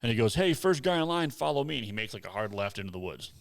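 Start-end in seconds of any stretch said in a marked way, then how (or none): 0.4-1.8 high called-out order, a mock guide's holler; calling out to the group. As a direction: call it across the trail to the first driver.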